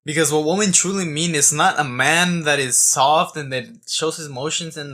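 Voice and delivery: ordinary talk, nothing marked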